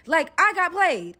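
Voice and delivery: loud, high, drawn-out grievance; indignant complaint. Retold or imitated. Captive complaining to captor she was fooled, indignant. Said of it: "I was like".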